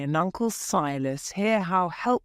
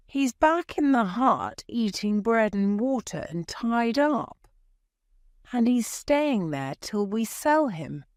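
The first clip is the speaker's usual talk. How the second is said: lightly voiced stern aunt, a bit higher; a hint of a strict old aunt laying down the law; Aunt Sally, stern; 7 semitones higher than usual. A narrator's reading. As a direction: read the aunt's lines firm and a touch higher. Aunt Sally scolds about Jim.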